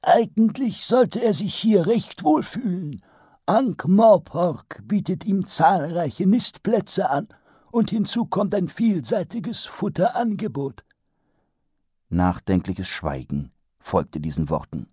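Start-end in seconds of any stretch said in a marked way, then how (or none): 0.0-10.8 put-on much higher, slow old wizard lecturing; pompous old wizard. A narrator's reading. Archchancellor lectures Vetinari on dragons, high and pompous. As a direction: hold forth like a self-pleased old professor.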